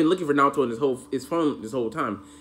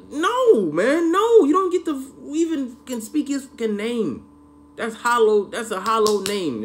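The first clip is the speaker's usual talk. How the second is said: high, loud, outraged no; a hammed-up angry refusal; furious shouting; 12 semitones higher, 6 dB louder, slower than usual. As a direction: shout him down in disgust. Raging at the villain.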